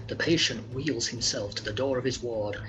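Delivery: ordinary delivery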